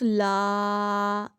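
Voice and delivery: "لا" held very long on the 'aa'; lengthened vowel. Shown as a demonstration. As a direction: stretch "لا" on one steady open vowel. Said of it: "آگے زیادہ اے لگا دو".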